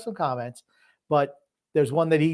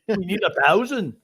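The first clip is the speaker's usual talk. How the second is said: Arnold attempt; 4 semitones higher than usual. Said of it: "I was trying to do Arnold".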